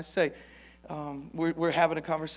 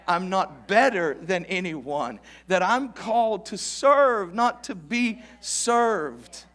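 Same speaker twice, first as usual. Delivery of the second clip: louder, higher, slow and emphatic preaching; full-force preaching; 7 semitones higher, 7 dB louder, slower than usual. Acted. Preacher urging his congregation to learn, full force. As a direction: drive the lesson home to the church.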